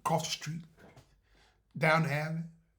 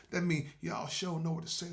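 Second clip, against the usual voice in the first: ordinary delivery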